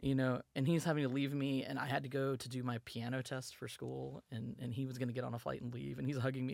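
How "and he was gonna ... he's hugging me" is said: ordinary delivery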